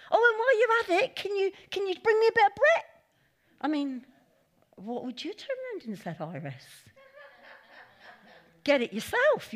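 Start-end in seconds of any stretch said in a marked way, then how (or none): 0.1-2.9 cheeky shout. Retold or imitated. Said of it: "She went off". 8.6-9.5 sharp, louder retort; indignant retort. Retold or imitated. Iris refusing Elijah's request, indignant. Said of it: "you'd have said".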